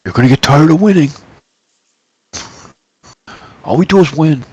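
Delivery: boastful Trump-style bragging; Trump impression. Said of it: "That's my best Trump im".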